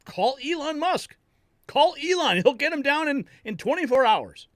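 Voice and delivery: insisting loudly, pitch way up, like it's obvious; excited urging. Retold or imitated. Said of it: "and I'm like".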